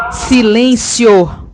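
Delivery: long drawn-out yell of silence; furious shout. Acted. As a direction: roar it slowly at the crowd. Censorship silencing the crowd.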